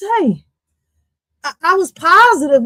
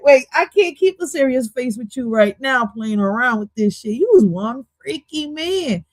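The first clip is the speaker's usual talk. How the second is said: ordinary talk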